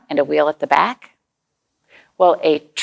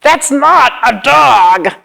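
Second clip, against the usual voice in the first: slow, louder, higher hammed-up alarm; mock alarm; 5 semitones higher, 6 dB louder, much slower than usual. Reader shows children the odd one out, hammed-up alarm. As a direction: make the kids gasp at it.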